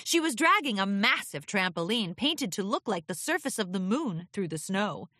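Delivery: plain reading voice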